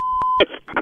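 high laugh. Real, not acted. Prankster breaks into laughter.

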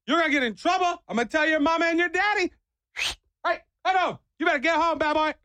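high mock scolding of a stray dog. Biker scolding a stray dog, high mock scolding. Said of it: "I'm like".

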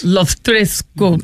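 "Los tres golpes" slowly, as a learner; slow, halting "Los tres golpes"; careful Spanish repetition. Shown as a demonstration. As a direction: sound out "Los tres golpes" after the teacher. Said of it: "I will learn this".

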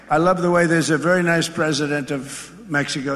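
ordinary delivery

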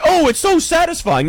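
high, mocking gush; mock the people who love sneezing. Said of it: "Some people are like".

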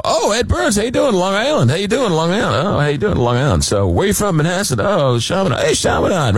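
high, eager fanboy chatter, acting casual; playing it cool. Host greeting a movie star, high and eager. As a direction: try to sound hip while starstruck. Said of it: "Playing it totally cool, totally hip".

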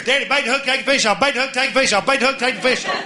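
put-on high, nagging kid voice repeating; whiny daughters. Dad mimics his daughters pestering him, high and nagging. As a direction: mimic the daughters' endless whining request. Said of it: "all you're gonna hear is".

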